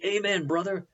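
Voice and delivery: high-pitched mock church-lady cry; imitated cry of approval, pitched high; crying out loudly. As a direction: call it out like a cheering congregant. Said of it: "the two ladies cried out loudly".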